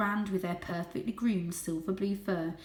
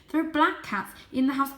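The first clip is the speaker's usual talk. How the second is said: higher, louder insistence; urgent explanation; 7 semitones higher, 6 dB louder than usual. A narrator's reading. Varjak repeats his warning, high and urgent. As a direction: make them listen. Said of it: "he tried to explain".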